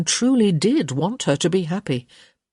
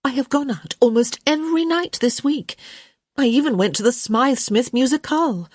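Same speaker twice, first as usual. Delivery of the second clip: put-on indignant young woman; 8 semitones higher than usual. Daughter defends herself.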